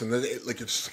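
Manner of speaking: usual speaking voice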